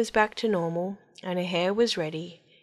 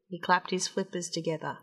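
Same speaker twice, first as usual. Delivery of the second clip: usual speaking voice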